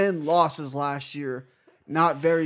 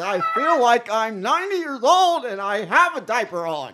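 high, loud, laughing complaint; mock-embarrassed wail through laughter; 7 semitones higher, 4 dB louder than usual. Real, not acted. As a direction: crack up while protesting. Embarrassed, cracking up at own accent.

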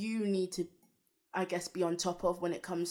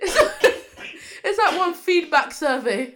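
loud laughing; 10 semitones higher, 10 dB louder than usual. Real, not acted. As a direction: blurt it out mid-laugh. Laughing joke.

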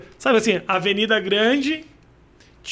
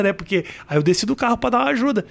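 ordinary talk, nothing marked

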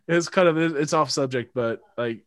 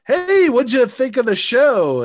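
much higher, louder, slow hopeful voice; bright hopeful question; 12 semitones higher, 9 dB louder than usual. Retold or imitated. Asking his date about the show, eager high question. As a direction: ask eagerly, fishing for praise. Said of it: "I'm like".

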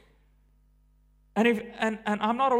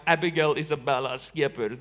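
lightly voiced stern dad, lower; a hint of dad using the full name; 6 semitones lower than usual. Parent saying the child's full name, lightly voiced dad voice. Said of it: "his dad voice".

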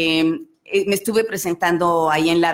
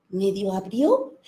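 lively, animated storyteller voice; playful children's storyteller; storytelling to children; 8 semitones higher than usual. Acted. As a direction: tell it like you're reading to a room of kids. Tells children's story on request.

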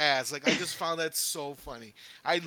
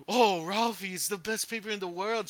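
gasp, then higher swooning mock praise; ecstatic gushing; 5 semitones higher, 5 dB louder than usual. Imitating Ralphie's teacher reading his paper, ecstatic gushing. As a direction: gush as if overcome with rapture. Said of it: "literally having orgasms over this paper".

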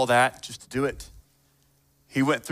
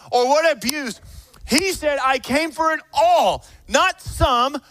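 full-force preaching; 10 semitones higher than usual. Real, not acted. Preacher telling the congregation that this is the gospel, impassioned preaching. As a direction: drive each phrase home with raised voice.

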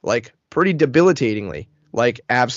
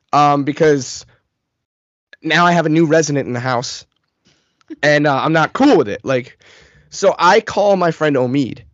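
ordinary delivery

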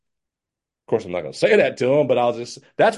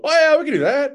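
mock-eager yes, much higher and louder; 6 dB louder than usual. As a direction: chirp it like an overeager pushover. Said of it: "never, ever, don't just go".

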